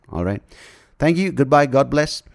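ordinary delivery

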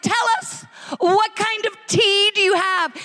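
excited American tourist asking for tea; gushing tourist. Retold or imitated. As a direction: gush at the trolley lady. Gushing tourists asking trolley lady.

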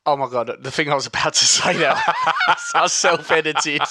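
cracking up while talking; helpless giggles at your own slip; laughing. Real, not acted. Cracking up at his own self-edit.

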